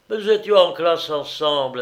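mildly indignant insistence; insisting. A narrator's reading. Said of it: "insistai-je".

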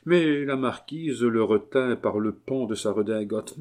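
plain reading voice

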